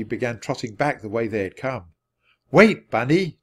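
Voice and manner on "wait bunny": louder, higher call with a drawn-out start; urgent storybook call. A narrator's reading. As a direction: call after a runaway animal. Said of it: "Jack called".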